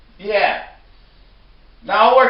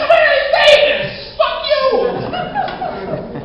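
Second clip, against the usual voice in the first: high squeaky mock yell; much slower than usual. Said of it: "I'm like".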